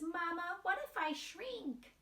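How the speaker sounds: put-on high small child voice; little mouse voice. Wemberly peeking at her parents, high and timid. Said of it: "she says".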